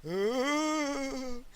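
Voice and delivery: long, high, hollow spectral wail; ghostly moan. A narrator's reading. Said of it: "the horrible spectre moaned".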